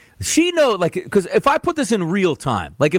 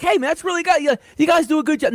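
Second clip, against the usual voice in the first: lightly voiced wife, pitched much higher; high-pitched mock compliment from the wife; imagined wife's praise; 11 semitones higher, faster than usual. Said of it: "she'll be like".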